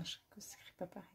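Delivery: fast, voiceless whisper after a laugh; hurried whispered admission; quick whispered aside. Real, not acted. Joke correction aside.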